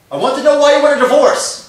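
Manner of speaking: loud, high demanding yell; shouting. Acted. Husband yelling at his wife about the divorce, loud. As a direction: demand an answer at full volume.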